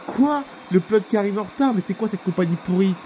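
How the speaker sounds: a sharp high "Quoi?" then indignant; outraged disbelief. Acted. Irate passenger to flight attendant, shocked and indignant. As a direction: passenger can't believe the delay excuse. Passenger hears pilot was late.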